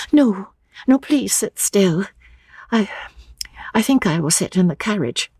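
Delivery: put-on high, stammering young woman; nervous girl tripping over her words; young Lucy, flustered. A narrator's reading. Lucy protesting to old Mr Emerson, flustered and high.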